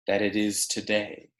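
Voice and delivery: ordinary delivery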